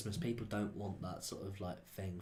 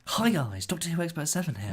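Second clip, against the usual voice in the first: higher, louder, cheesy channel greeting; YouTuber intro; 8 semitones higher, 7 dB louder than usual. Pretend. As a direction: greet the fans like a fan channel host.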